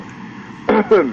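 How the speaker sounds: sudden choking cough on a drink. Real, not acted. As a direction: cough as if a drink went down wrong. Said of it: "me atraganté por estar tomando un trago".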